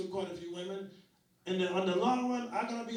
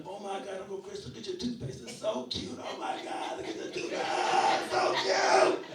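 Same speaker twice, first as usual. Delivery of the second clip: gushing little-girl imitation building to a squeal; kids losing their minds; 4 semitones higher, 4 dB louder than usual. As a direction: mimic children going wild with delight, ending loud. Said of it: "So they lose their mind".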